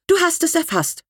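the eccentric aunt crowing that she's been understood; high proud little crow; triumphant aunt. Acted. Aunt triumphantly confirms kilt.

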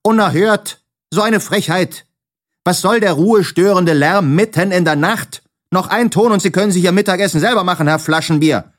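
high, scolding landlady; outraged calling. A narrator's reading. Frau Rotkohl calls through the door at Herr Taschenbier, outraged scolding. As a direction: scold indignantly, high-pitched, rushing the threat. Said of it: "rief von draußen".

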